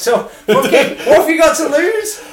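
laughing and loud, high. Real, not acted. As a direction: cheer him on through laughter. Laughing, urging him on.

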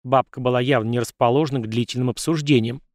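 plain reading voice

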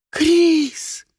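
high, loud cry of a name; desperate scream. Acted. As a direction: scream it in despair. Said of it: "это кричала Диана".

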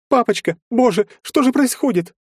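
very high, loud tearful cry; distraught high-pitched lament; wailing. A narrator's reading. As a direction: daughter wailing in panic. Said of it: "заревела Ольга".

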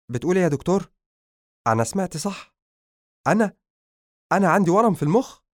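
high, loud cry of shock and disbelief; a young man can't believe his ears, voice jumping up; stunned disbelief. A narrator's reading. Young man hearing he has a brain tumour, shocked. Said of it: "بصدمة".

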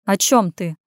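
usual speaking voice

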